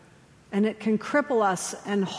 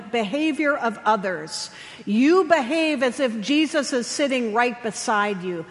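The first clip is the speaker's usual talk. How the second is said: usual speaking voice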